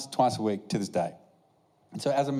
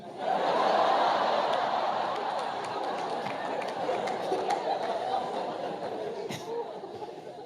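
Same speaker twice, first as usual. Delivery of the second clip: high, helpless fit of laughter; long laugh. Real, not acted. Comedian recalling the race owner's medal, long helpless laugh. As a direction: laugh till you can't stop.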